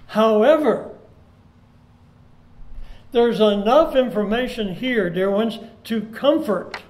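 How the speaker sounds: slow, earnest pulpit preaching with swells. Acted. Pastor comforting grieving congregation, earnest slow preaching. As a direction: preach comfort, leaning on each phrase.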